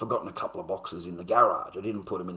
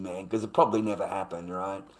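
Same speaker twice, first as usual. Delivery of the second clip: ordinary talk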